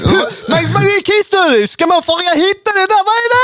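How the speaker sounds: high squeaky mock bewilderment; Adde Malmberg impression. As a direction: ham up the baffled comedian. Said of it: "Adde Malmberg hade en ståupprutin om det".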